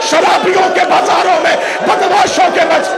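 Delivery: impassioned majlis sermon, half-shouted and rising; fervent lament sermon, rising toward a cry; preaching at full force. Acted. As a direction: preach it out to the mourners, pushing each line harder. Sermon climax continues shouting.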